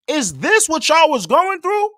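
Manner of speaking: high, loud and slow, stunned; the penny drops, say it in disbelief; shocked realisation. Real, not acted. Sudden realisation about Texas.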